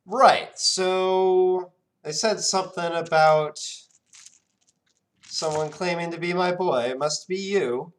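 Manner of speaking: delighted genie spotting his son; excited genie. Acted. Genie realizing the boy is his, high and booming.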